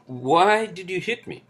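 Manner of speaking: high, slow "why did you hit me?"; imitated angry driver. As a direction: play the annoyed driver. Other driver confronting cab driver.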